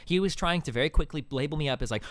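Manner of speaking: ordinary talk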